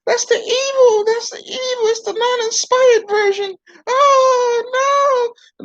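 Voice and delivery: a frantic dummy crying no; puppet voice. Acted. Ventriloquist puppet panics at NIV.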